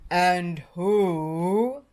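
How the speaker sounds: loud, booming giant; thundering giant. A narrator's reading. Giant demanding who the tiny intruder is, booming. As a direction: boom it out like a giant. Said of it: "thundered the giant".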